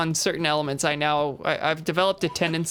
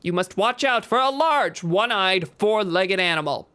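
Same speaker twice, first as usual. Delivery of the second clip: booming news anchor read; newscaster voice. Acted. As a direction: read it like a breaking news bulletin. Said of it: "like, a newscaster voice".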